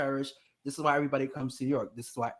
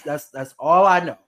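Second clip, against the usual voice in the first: usual speaking voice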